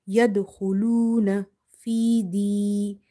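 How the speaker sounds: measured recitation with a long u; recitation demo. Shown as a demonstration. Teacher modelling the word for learners, measured recitation. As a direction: recite evenly, stretching the long vowel.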